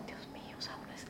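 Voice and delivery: breathless whispered dread. Retold or imitated. Mother recalling dread of the hospital calling, whispered. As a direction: whisper it like a frightened prayer. Retold fear of hospital calls.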